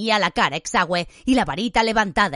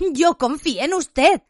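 higher, slow, voice cracking; Harry shouting his betrayal at Lupin; breaking shout; 6 semitones higher, 3 dB louder, slower than usual. A narrator's reading. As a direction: shout it as the voice gives way. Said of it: "gritó a Lupin flaqueándole la voz".

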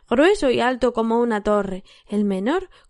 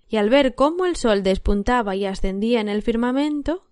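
ordinary delivery

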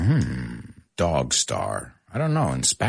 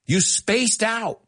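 wife calling out; 11 semitones higher than usual. Retold or imitated. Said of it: "And she's like".

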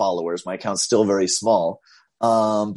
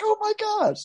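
much higher, breathy awe; teen stunned at meeting the famous teacher; starstruck gasp. Retold or imitated. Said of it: "He's like".

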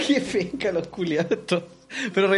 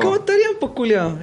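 mock outrage; 10 semitones higher than usual. Mocking outraged commenters.

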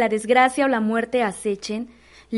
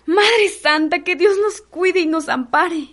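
higher, alarmed plea to heaven; frightened invocation; 5 semitones higher than usual. Acted. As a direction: pray for protection in alarm. Maid frightened by the omen. (súplica asustada)

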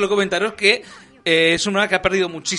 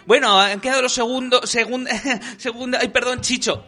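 higher, stumbling presenter imitation; fumbling TV hostess; 6 semitones higher than usual. Hostess flubbing the results to her co-presenter, flustered imitation. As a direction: trip over the line and apologise. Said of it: "se equivoca Miriam de Azaroca".

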